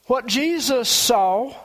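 ordinary delivery

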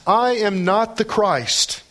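"I am not the Christ" slow and high, each word emphasized; emphatic denial. Acted. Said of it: "It's actually emphatic in the Greek".

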